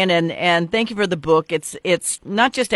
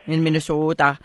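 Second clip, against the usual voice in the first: put-on Minnesota accent; 3 semitones lower, 4 dB quieter than usual. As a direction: tease with a long Minnesotan O. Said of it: "I heard it in your voice".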